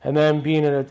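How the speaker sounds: usual speaking voice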